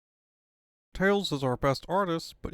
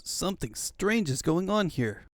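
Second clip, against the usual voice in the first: usual speaking voice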